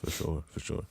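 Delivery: usual speaking voice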